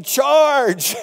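high, loud cry of "Charge"; rallying battle cry. Retold or imitated. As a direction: yell it like a general waving his men on. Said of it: "waving his coat saying".

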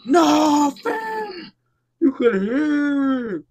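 very loud, high-pitched shout of real alarm; freaked-out yell. Real, not acted. Streamer hearing a ghostly whisper in a video, freaked-out yell. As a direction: cry out high and loud, stretching the words in fright.

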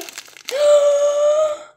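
loud, high, long gasp; delighted gasp. Real, not acted. Vlogger first seeing the doll, loud delighted gasp.

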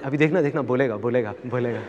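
low, soft aside, fading away; imitated conspiratorial murmur; aside to companions. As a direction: tip off those beside you under your voice. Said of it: "अपने साथ वालों को बोलते".

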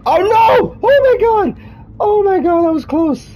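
shrill frightened shriek, then relief; panicked scream. Real, not acted. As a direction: scream in panic at the close call. Near death in game.

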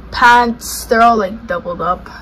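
ordinary talk

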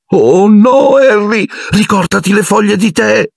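very high, anxious squeaky stage whisper; urgent hushed warning. A narrator's reading. Lavanda and Parvati warn Harry, anxious and high. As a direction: plead in a high, alarmed voice. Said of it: "Lavanda e Parvati sussurrarono".

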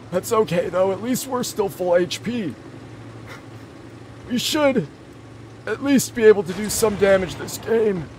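put-on high, loud, strained old man voice. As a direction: push the old man voice hard. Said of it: "I'll still play like Old Man Blitz".

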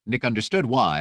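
plain reading voice

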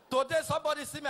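usual speaking voice

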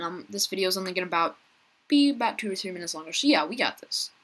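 normal talking voice

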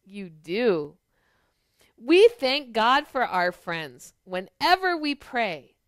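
ordinary delivery, nothing marked

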